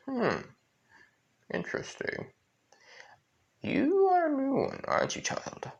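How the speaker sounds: high, sly devil purring over a newcomer; a sly, high-pitched devil sizing up a child; Lucifer voice. Acted. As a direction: lift it high and toy with the child.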